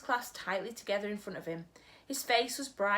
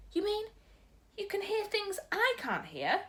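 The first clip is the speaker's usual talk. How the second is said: put-on higher, wondering child voice; little girl Sophie; 13 semitones higher than usual. Sophie asking the giant, amazed.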